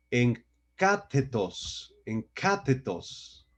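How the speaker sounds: encátetos said slowly, stressed on the syllable CÁ. Shown as a demonstration. Preacher teaching the Greek word for spies, stress on encátetos' CÁ. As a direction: draw out encátetos with the accent on the first A. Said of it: "Con acento en la A".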